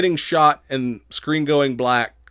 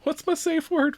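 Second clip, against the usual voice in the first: mock high voice. Acted character quote.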